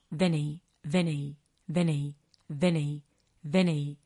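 D N I run together fast, letters blurred into one word; fast run-together DNI. Shown as a demonstration. Spanish teacher showing learners the fast native DNI. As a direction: say D N I quickly like natives do. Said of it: "Decimos D N I".

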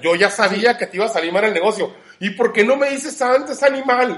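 loud, high, outraged yelling; indignant rant. Retold or imitated. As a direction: yell it at the know-it-alls. Ranting at know-it-all fortune tellers.

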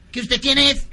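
insistent cartoon alien; pestering Martian. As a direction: challenge a silent stranger, comically. Martian pestering the fuel pump.

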